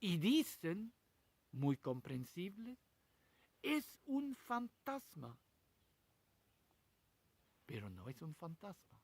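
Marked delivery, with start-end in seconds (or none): 3.6-5.4 high, slow cry of terror at a ghost; frightened cry. Acted. Terrified disciples in the storm cry out, high and slow. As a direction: gasp it out as if seeing a ghost.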